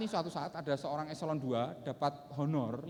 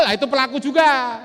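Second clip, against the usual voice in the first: loud retort; 9 semitones higher, 15 dB louder than usual. Acted. Loud punchline retort after acted shouts.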